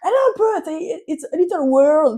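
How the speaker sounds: high, loud, slowing caricature; imitated German friend. German girl speaks approximate English about sister, imitated high loud voice.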